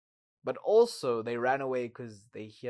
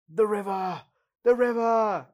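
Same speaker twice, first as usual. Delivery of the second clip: last cry; 8 semitones higher, 9 dB louder than usual. Retold or imitated. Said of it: "get one last word out".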